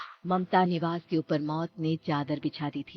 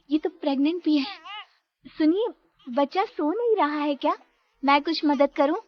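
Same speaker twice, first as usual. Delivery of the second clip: high, louder, eager offer of help; concerned young daughter-in-law; 9 semitones higher, 7 dB louder than usual. Acted. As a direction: call over to the neighbour, pitched high. Priya offers help to neighbour. (चिंतित बहू की ऊँची आवाज़)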